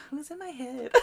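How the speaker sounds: high, soft near-whisper; hushed astonishment. Retold or imitated. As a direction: wonder it softly to yourself. Retold astonished thought.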